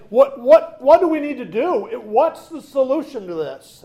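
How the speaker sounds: high, loud, pleading cry for an answer; desperate cry. Retold or imitated. Convicted crowd cries out to the apostles, high and loud. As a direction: cry out in alarm, begging to know. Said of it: "They cry out and say".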